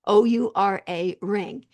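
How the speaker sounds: O U R A spelled slowly, letter by letter; "O U R A" said as four separate slow letters; spelling it out. Shown as a demonstration. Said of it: "this is an Oura ring".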